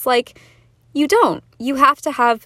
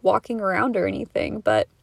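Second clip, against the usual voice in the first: usual speaking voice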